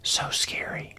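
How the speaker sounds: whispered awe; hushed whisper. Real, not acted. Fan recalling a scary film villain, whispered. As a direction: say it under your breath, barely voiced. Awed aside about a frightening film.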